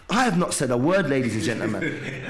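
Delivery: usual speaking voice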